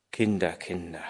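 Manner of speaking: very soft, low murmur to himself; hushed, low and musing. A narrator's reading. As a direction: murmur it in quiet wonder. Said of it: "murmelte Vater Martin leise".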